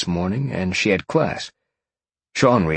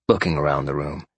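usual speaking voice

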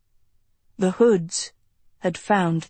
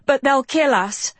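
frightened cry; 6 semitones higher, 3 dB louder than usual. A narrator's reading. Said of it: "Agatha cried".

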